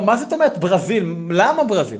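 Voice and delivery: high, loud baffled questions; incredulous protest. Acted. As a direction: object in disbelief, hitting each question. Protests being sent to Brazil.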